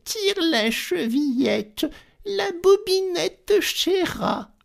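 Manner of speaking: very high, slow granny call; old grandmother calling. Acted. Grandmother in bed calling to the door. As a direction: call out like a frail old woman. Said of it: "lui cria".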